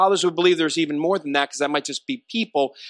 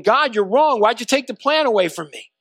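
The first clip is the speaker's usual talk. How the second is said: higher, louder indignant complaint; angry protest; 6 semitones higher, 4 dB louder than usual. Acted. Preacher voices Jonah blaming God over the plant, angry. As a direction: protest like someone wronged, pitch raised. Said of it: "Now he's angry because the plant".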